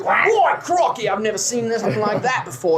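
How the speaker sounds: a burst of Australian amazement, then settling; Aussie barker blown away by the shot; amazed Australian carny. Acted. Carny marveling at Crocky's lucky shot in light Australian. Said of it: "and he goes".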